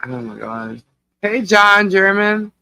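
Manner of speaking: ordinary delivery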